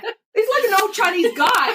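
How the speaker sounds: very high, tickled squeal; incredulous, giggly high voice; laughing disbelief. Retold or imitated. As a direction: protest to yourself through laughter. Incredulous retelling through laughter.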